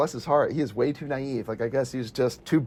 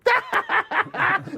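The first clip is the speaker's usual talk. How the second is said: high-pitched imitated laughing fit; mock guffaw. Police laughing at the friend's story, high mock laughter. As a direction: act out a roomful laughing at someone.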